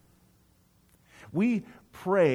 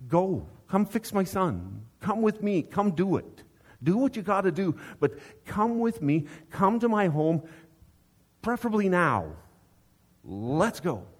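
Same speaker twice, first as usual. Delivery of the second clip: higher, insistent father hurrying Jesus; urgent father; 6 semitones higher than usual. Retold or imitated. Father urging Jesus to come to his home, higher and urgent. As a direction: press Jesus to come right now. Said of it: "his son's in Capernaum".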